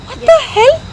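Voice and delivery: high, stunned what the hell; shocked outburst, voice flying up; disbelief. Real, not acted. Outraged at the unfair fine.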